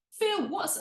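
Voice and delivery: a soap character calling out to Phil, bad EastEnders accent; mock EastEnders voice. EastEnders character asking Phil, high hammed-up EastEnders accent. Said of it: "I can't do an accent".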